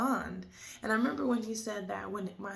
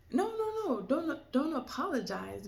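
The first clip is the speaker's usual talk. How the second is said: urgent protest; 6 semitones higher than usual. Retold or imitated. Father quickly stops her apology.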